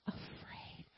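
hushed whisper of the word; breathy stage whisper to the congregation. Acted. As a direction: whisper it like a secret. Whispered answer to his question.

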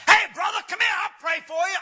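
high, booming hammed-up summons; imitated preacher's yell. Pastor calling the last man in line, yelling. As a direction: holler like an over-eager pastor. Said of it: "And he yelled out".

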